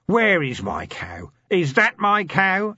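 slow, loud, rising demand. Acted. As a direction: advance on the dwarfs demanding the cow. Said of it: "it demanded".

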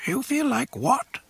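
high, odd little creature voice; squeaky curious swamp creature; Yoda voice. Acted. Tiny creature speaks suddenly.

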